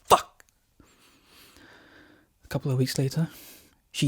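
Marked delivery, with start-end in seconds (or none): 0.0-0.4 panicked curse. Acted.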